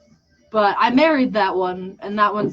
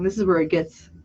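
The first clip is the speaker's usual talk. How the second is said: ordinary talk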